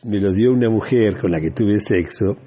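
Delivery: ordinary talk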